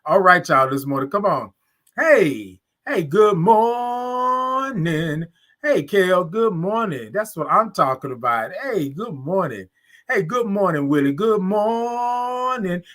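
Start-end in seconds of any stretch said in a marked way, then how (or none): 3.0-5.2 "morning" held very long, high; drawn-out good morning. Real, not acted. Host greeting all the viewers, "morning" drawn way out. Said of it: "all those O's and R's and I N G's". 11.1-12.8 "morning" held very long, high; drawn-out good morning. Real, not acted. Host greeting all the viewers, "morning" drawn way out. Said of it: "all those O's and R's and I N G's".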